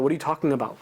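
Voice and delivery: ordinary talk, nothing marked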